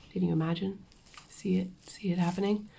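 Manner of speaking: quick coaxing whisper. Real, not acted. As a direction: hush it like a secret. Coaxing viewers to imagine.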